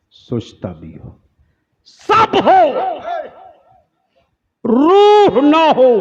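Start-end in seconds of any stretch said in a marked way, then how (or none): 1.8-5.9 high, loud, drawn-out cry; preaching shout. Acted. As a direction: hurl it at the crowd, slowly. Preacher's rising climax.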